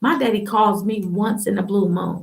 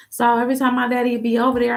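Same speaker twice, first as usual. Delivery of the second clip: ordinary delivery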